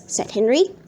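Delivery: ordinary delivery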